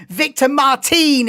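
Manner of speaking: Victor Martin said the French way, no final s, slowed; French accent. Shown as a demonstration. Streamer jokingly saying the driver's name in French accent. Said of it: "if you wanna say it with a French accent".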